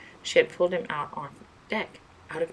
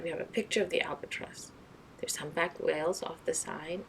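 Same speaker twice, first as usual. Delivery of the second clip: ordinary delivery, nothing marked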